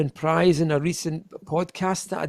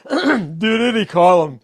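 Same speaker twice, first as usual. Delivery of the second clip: American accent; 2 semitones higher, 5 dB louder, slower than usual. Shown as a demonstration. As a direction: drawl "Giretti Column" like an American. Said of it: "If I was American".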